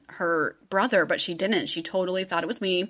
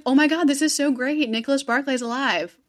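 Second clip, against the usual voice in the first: higher, louder excited crowd reaction; mock excitement; 6 semitones higher, 3 dB louder than usual. Everyone hears the boy is alive, mock excited. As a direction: gush with relief, in a retelling. Voicing everyone's joy at the news.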